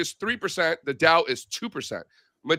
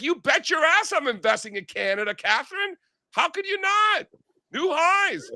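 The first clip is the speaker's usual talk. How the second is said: much higher, pumped-up bragging comeback; excited retort; 13 semitones higher than usual. Retold or imitated. Telling his co-host friend off air, high excited brag. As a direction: fire it back at her, cocky and thrilled. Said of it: "I'm like".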